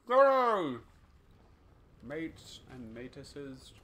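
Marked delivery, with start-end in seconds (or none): 0.1-0.8 high, very loud drawn-out cheer; big playful holler; hammed-up shout. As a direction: bellow it in mock praise. Echoing the other's call, hammed up.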